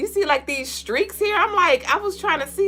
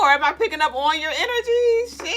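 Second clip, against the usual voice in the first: normal talking voice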